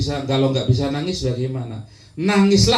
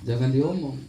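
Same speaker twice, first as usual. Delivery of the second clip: ordinary talk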